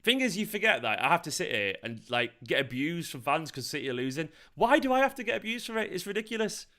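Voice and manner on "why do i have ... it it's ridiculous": high-pitched exasperated complaint; real aggrieved exasperation; indignant protest. Real, not acted. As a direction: protest the injustice, voice climbing. Protests abuse from fans.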